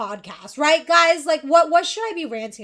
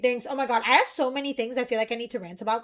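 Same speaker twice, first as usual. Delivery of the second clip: usual speaking voice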